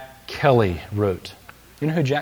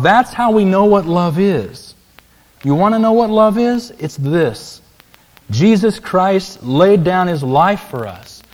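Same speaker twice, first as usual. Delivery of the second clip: higher, louder and slow; emphatic preaching; 6 semitones higher, 5 dB louder, slower than usual. Real, not acted. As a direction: proclaim it to the congregation. Preacher driving home the point.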